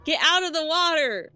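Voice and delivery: loud, frantic commands; yelling. Real, not acted. Gamer yelling at Pikmin stuck in water, loud. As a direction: shout at them to get out.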